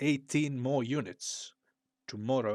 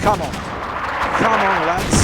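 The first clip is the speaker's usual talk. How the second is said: rallying shout; 3 semitones higher, 13 dB louder, faster than usual. Pretend. Urging troops in strategy game.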